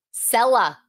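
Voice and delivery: "Cellar" with no final R, like "sella"; Boston "Cellar". Shown as a demonstration. Teacher announcing a vocabulary word, Boston accent. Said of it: "Instead of the basement".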